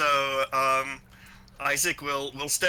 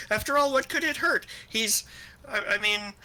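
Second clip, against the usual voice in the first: plead, voice rising as you go; 11 semitones higher than usual. Acted. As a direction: pitch climbing higher and higher.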